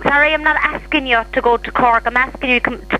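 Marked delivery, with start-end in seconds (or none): none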